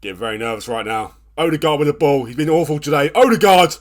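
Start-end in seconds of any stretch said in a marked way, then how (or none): 3.2-3.8 loud exasperated yell at player; frustrated shout. Real, not acted. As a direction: bark the name in frustration.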